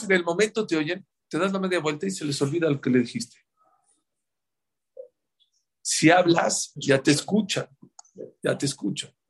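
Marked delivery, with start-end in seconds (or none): none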